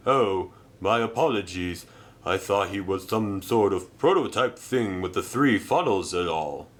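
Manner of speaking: altered crane voice, sheepish; lightly voiced sorry crane. Reg apologising to Arthur, altered and sheepish. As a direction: apologise for the mistake.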